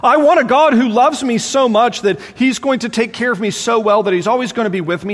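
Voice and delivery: ordinary talk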